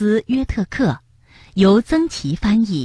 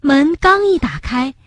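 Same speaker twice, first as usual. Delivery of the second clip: ordinary delivery, nothing marked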